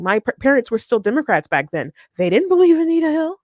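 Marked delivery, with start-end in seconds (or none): 2.2-3.4 raised, louder voice with a rising end; emphatic, high and loud; high-pitched emphasis. Real, not acted. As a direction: pitch it way up and push it out. Repeats parents' disbelief for emphasis.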